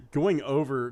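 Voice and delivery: ordinary talk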